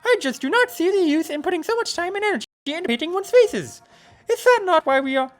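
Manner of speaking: very high, haughty lady; snooty high voice. Acted. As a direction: look down your nose, high. Posh character, very high.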